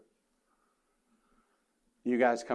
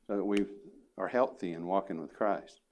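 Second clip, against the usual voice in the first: usual speaking voice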